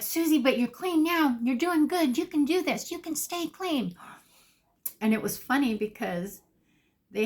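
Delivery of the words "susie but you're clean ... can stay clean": high-pitched imitation of supportive peers; encouraging group members. Treatment group reassures crying newcomer, high-pitched encouragement. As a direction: pitch it high, cheering her on.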